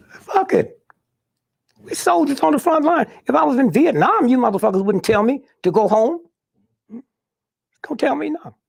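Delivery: angry outburst. Retold or imitated. Retells his defiant outburst.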